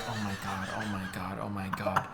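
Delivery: soft, low mortified muttering; retold shrinking under your breath. Mortified kid to himself on the bus, muttering low. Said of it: "I was so mortified".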